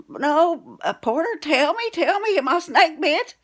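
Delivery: high, loud, anxious begging; a panicked wife pressing her husband for an answer; frantic pleading. Retold or imitated. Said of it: "she'd fuss at".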